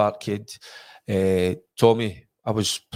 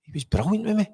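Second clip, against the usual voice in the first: put-on excited young self; 9 semitones higher than usual. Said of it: "I says".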